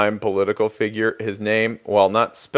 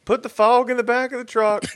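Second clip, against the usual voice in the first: high-pitched mock West Virginia yokel; cartoonish West Virginia twang, pitched way up; bad West Virginia accent; 13 semitones higher than usual. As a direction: holler it as a badly faked West Virginia local. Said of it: "I can't even do a West Virginia accent".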